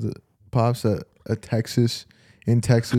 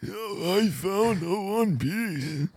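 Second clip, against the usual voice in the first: put-on old man voice; 7 semitones higher, 8 dB quieter, much slower than usual. Aged Luffy at Egghead.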